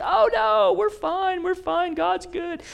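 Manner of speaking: high, sing-song mocking voice; mock the comfortable crowd; complacent people. Preacher mocking complacent people in a high voice. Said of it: "He's saying to his people who are comfortable".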